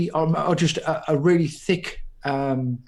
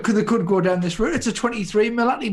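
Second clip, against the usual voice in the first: ordinary talk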